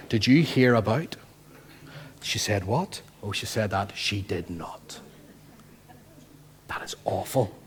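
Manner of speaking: scandalised gossip, sliding into a hushed aside; gossiping voices. Acted. Preacher acts out churchgoers swapping gossip, hushed and scandalised. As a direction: gasp and gossip like church busybodies.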